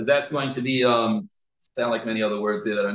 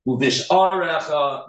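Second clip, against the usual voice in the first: slow careful pronunciation; 3 semitones higher, 4 dB louder, slower than usual. Shown as a demonstration. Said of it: "make sure we pronounce".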